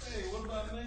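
soft, far-off call; muffled prisoner. Acted. Locked-up grudge calling to the preacher from afar. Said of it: "You know what I hear?".

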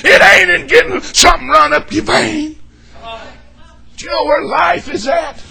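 loud, shouted Baptist preaching; fiery revival preaching. Acted. Preacher shouting the altar call at his congregation. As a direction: preach it hard to the pews.

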